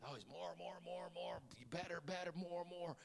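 whispered greedy chant. Pretend. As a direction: hiss it softly, like a greedy inner voice.

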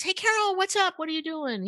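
high-pitched retold hello; imitated breezy greeting. Retelling a colleague's casual greeting.

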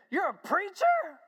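high, loud, astonished questioning; retold incredulous outburst. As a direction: challenge him as a flabbergasted Harry. Harry stunned to learn he's preacher.

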